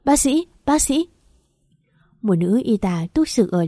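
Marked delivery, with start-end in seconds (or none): 0.1-1.1 high, loud call for the doctor; mother bursting into the hospital, calling for a doctor. Acted. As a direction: cry out for help down the hall. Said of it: "chạy vào bên trong gọi lớn".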